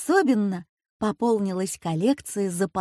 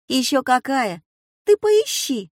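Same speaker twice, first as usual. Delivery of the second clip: high, loud insistent cry; emphatic urging; 5 semitones higher, 5 dB louder than usual. A narrator's reading. Granddaughter urging grandma to search, loud high insistence. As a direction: push her to go look, loudly.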